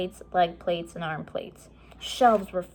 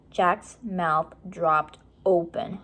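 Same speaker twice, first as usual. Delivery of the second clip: ordinary delivery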